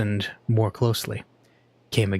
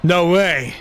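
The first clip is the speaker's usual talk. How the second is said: louder curt no; snapping refusal; 6 semitones higher, 5 dB louder than usual. A narrator's reading. Passenger refusing the begging stranger, snapping. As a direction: snap it shut. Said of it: "Minoru Kawa snapped".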